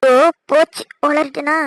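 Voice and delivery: sudden loud dismay at own slip; startled gasp of having blurted it out; oops realization. Acted. Daughter catching her own slip, loud oops.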